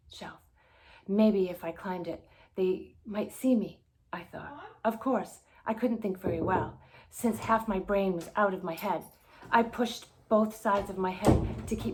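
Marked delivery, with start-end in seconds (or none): none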